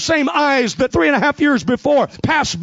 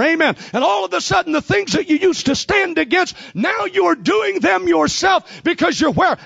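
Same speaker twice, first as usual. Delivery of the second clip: rising, ringing pulpit cadence; hammering preacher's build; full-force preaching; 5 semitones higher than usual. Acted. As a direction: preach it hard to the congregation.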